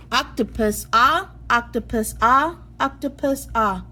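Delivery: "ah" as in "octopus", open and stressed; short o sound. Shown as a demonstration. Teacher showing kids the short o sound, exaggerated. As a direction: hit the "ah" before "octopus". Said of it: "And you're gonna say".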